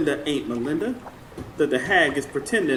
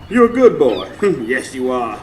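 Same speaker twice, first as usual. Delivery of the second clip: praising the dog; 6 dB louder than usual. Acted.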